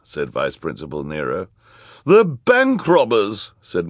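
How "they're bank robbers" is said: high, loud, drawn-out voice; accusing character. A narrator's reading. Missus Bass accusing the children, high loud drawn-out voice. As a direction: stretch the accusation out loudly.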